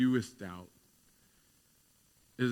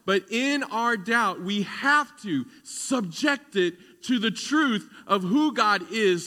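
fervent preaching; 7 semitones higher, 8 dB louder than usual. Acted. Pastor urges the congregation to submit doubt to truth, fervently preached.

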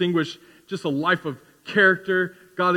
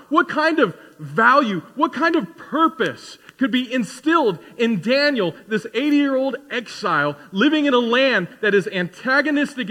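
higher, louder preacher build, words piling up; full-force preaching; 8 semitones higher, 6 dB louder than usual. Acted. Pastor pressing the congregation, at full force. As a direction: drive the question home from the pulpit.

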